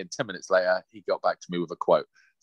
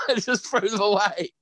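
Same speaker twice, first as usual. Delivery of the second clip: ordinary delivery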